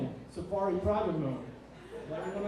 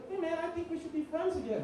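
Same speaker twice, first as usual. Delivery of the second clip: sweet rival voice; 10 semitones higher than usual. Retold or imitated. Rival voiced asking friendship.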